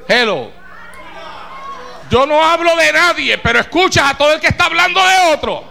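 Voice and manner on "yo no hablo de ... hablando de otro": mocking shout. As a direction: throw their excuse back at them. Preacher mocks gossip lovers.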